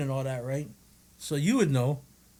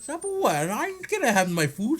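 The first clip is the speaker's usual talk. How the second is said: mock outraged retort; 9 semitones higher than usual. Acted retort to kid.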